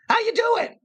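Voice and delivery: very high, strained squeak; even a casual greeting in a pinched, high cartoon voice; Usopp voice. Shown as a demonstration. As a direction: squeeze it way up like a jittery Usopp. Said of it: "Even when he's just sitting next to you".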